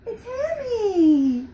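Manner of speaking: put-on high, loud excited cry to a child; delighted reveal. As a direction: squeal the name with delight. Revealing who is in mirror.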